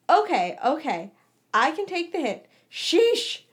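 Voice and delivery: high, loud defensive protest. A narrator's reading. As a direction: back off with hands up. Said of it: "James said defensively".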